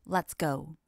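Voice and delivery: ordinary delivery, nothing marked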